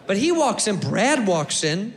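ordinary delivery

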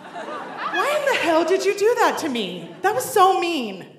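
high-pitched wounded outburst; acted indignant protest. Woman confronting old tormentor after drinks, acted indignation. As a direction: confront them like a hurt kid, hammed-up.

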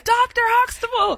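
high, surprised call of a friend; recognizing call. Retold or imitated. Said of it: "his buddy recognizes him and he's like".

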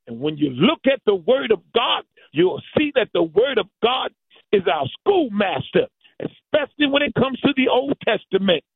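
usual speaking voice

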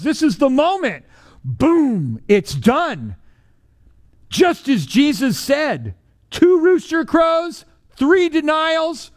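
preacher building to the climax of the denial; preaching climax. Real, not acted. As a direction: drive the moment home. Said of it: "preaching climax".

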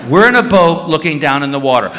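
ordinary talk

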